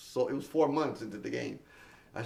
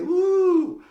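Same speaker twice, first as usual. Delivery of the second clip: high loud whoop; big joyful woo shout; excited whoop; 11 dB louder than usual. Real, not acted. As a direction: celebrate the last seconds. Countdown hype.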